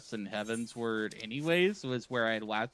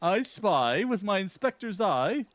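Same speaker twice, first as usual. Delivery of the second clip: pompous gentleman hero, brisk and theatrical; Hildebrand; 6 semitones higher than usual. Acted. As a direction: read it like a vain, grandiose gentleman. Voicing Hildebrand's quest lines.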